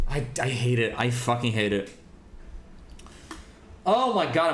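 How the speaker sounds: breathless after ranting; winded, catching breath. Real, not acted. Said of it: "I'm running out of breath".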